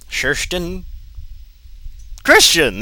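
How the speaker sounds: loud high baffled outburst; exasperated realization. Real, not acted. Reader decoding a typo in the fanfic, baffled shout. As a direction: shout it out as it finally clicks.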